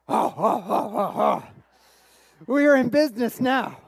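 hammed-up gloating delight; gleeful discovery. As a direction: relish the lucky find. Retells delight at finding starter log.

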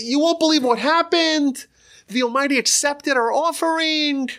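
breathless delight at a miracle; overjoyed news. Retold or imitated. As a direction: burst in with amazing news. Simpleton tells wife, overjoyed.

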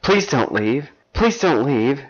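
high-pitched desperate begging, acted; pleading. A narrator's reading. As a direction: beg your lover to stay. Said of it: "she pleaded".

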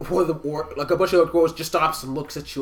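normal talking voice

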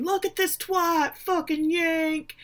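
slow, high-pitched mock yell; imitated yelling. Bar patrons jeering at the press conference on screen, imitated yelling. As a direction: imitate a pub heckle without real volume. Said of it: "they're yelling".

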